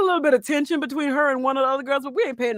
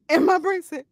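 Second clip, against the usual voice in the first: normal talking voice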